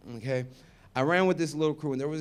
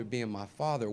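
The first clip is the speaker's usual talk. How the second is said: ordinary talk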